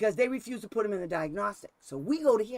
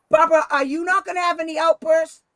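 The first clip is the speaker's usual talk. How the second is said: loud, high-pitched acted exchange; calling out; 8 semitones higher, 10 dB louder than usual. Asking Barbara at the door about outbursts, calling out. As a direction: project it across the room to the doorway. Retold exchange shouted through doorway.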